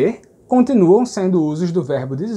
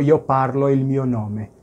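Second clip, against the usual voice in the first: slow wrong example; 3 semitones lower than usual. Shown as a demonstration. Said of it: "Isso não existe".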